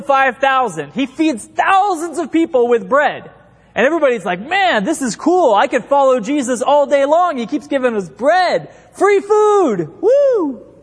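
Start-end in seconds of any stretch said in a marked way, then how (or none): 4.4-10.7 hammed-up delight, rising to a high whoop; excited crowd. Crowd cheering at getting fed by Jesus, mock elation. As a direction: play a follower thrilled by the free bread.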